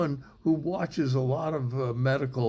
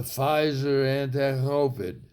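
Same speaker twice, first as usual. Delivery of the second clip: put-on drunk. Comedian mocking a drug name as drunken speech, drunk slurring. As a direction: slur it like a drunk. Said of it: "a drunk trying to say".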